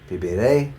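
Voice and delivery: viviré with emphasis on ré; viviré, rising onto a strong ré; final-syllable stress. Shown as a demonstration. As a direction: punch the last syllable of viviré. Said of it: "stressing that very last syllable".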